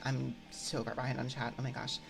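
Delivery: normal talking voice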